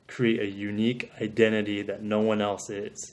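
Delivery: ordinary talk, nothing marked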